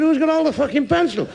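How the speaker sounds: high, indignant question; acted schoolkid's baffled outburst; exasperated pupil. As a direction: pipe up in high-pitched protest. Exasperated pupil interrupts the drill.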